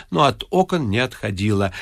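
ordinary delivery, nothing marked

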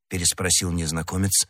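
plain reading voice

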